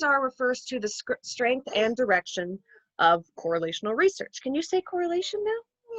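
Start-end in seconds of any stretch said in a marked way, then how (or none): 4.4-6.0 higher coaxing voice. Real, not acted. Coaching toddler to repeat.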